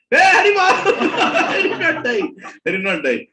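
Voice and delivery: high, loud joyful outburst; laughing relieved shout. Retold or imitated.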